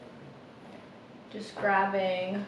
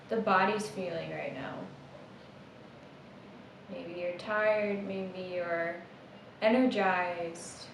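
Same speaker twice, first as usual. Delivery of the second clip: soft, unhurried meditation guide; hushed meditation voice trailing into a whisper; guided meditation; 9 dB quieter than usual. Acted. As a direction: ease them into noticing the breath, gently.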